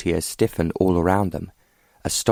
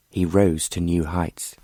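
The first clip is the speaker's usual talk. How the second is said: plain reading voice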